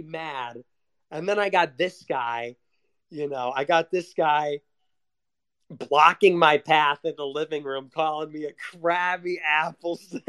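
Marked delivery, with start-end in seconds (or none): none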